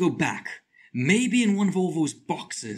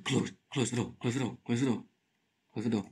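urgent hush; 5 semitones lower, 6 dB quieter, faster than usual. Real, not acted. Hiding, urging door shut.

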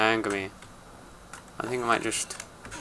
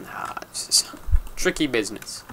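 ordinary delivery

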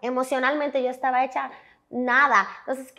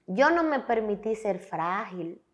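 ordinary talk, nothing marked

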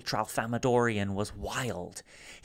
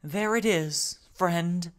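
high, louder, halting alien voice; speaking haltingly; 8 semitones higher, 4 dB louder, slower than usual. Acted. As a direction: Salo piecing his words together. Salo to memory of Rumford.

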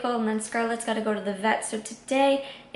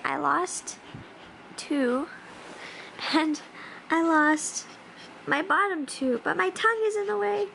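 puppy voice; 5 semitones higher than usual. Pretend.

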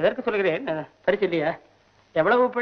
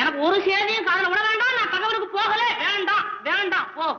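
high comic rant; 8 semitones higher than usual. Acted. High comic ranting alone.

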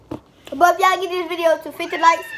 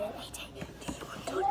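whisper; 7 semitones lower, 19 dB quieter than usual. Retold or imitated. Quoting softly.

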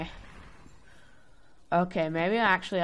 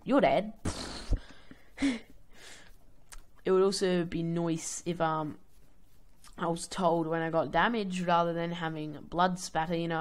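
ordinary delivery

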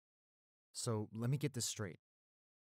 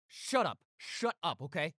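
angry; 6 semitones higher, 5 dB louder than usual. Acted. Snapping at attacker.

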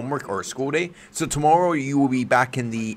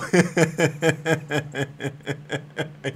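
nervous laugh; 5 semitones higher than usual. Real, not acted. Laugh after near slip.

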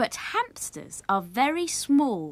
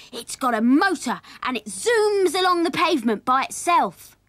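put-on loud, raised child-character brag; excited boasting; 7 semitones higher, 7 dB louder than usual. A narrator's reading. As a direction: show off like a thrilled small boy. Sammy boasts excitedly in story.

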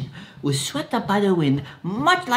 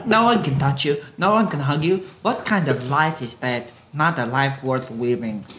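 put-on Russian accent; 7 semitones lower than usual. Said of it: "Your Russian accent has slipped a little bit".

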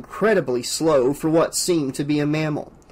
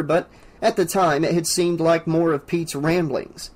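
usual speaking voice

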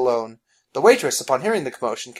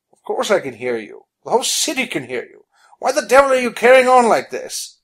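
annoyed; 8 semitones higher, 6 dB louder than usual. Acted. William answering from behind.

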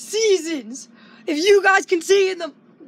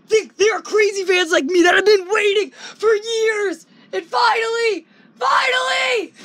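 higher, impassioned fanboy outburst; real elation, getting higher to the end; going crazy; 5 semitones higher than usual. Real, not acted. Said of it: "I'm gonna be done going crazy".

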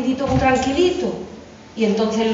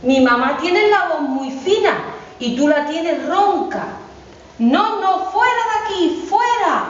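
put-on higher kid-goat voice turning him away, slower in the middle; higher, slow little goat saying the voice is wrong; brave little goat; 7 semitones higher, slower than usual. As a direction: refuse the wolf like a plucky little goat. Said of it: "le dice el cabrito".

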